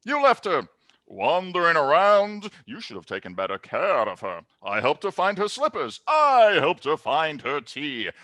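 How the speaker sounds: loud theatrical gloating, rising at the end; gloating villain. Acted. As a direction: rant and build to a triumphant roar. Game master acts gloating villain manager.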